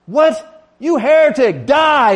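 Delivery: loud, high-pitched explosion of outrage; hammed-up enraged scream; outraged shout. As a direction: yell it as a furious denunciation. Joke punchline, outraged attack.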